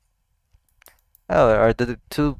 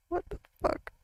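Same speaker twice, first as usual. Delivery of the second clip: stunned squeak; 13 dB quieter than usual. Real, not acted. Shocked reaction continues.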